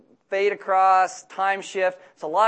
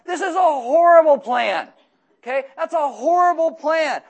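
higher, louder and slow on "horrible"; emphatic condemnation; 8 semitones higher, 7 dB louder, slower than usual. Real, not acted. Preacher tells the church how terrible Reuben's plan is, loud and slow. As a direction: hammer home how bad the plan is. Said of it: "preacher condemns Reuben's plan".

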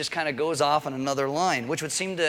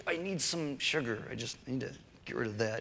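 craving sugar; 10 dB quieter than usual. Retold or imitated. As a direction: moan it like a sugar addict. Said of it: "I'm like".

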